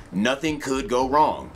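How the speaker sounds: ordinary talk, nothing marked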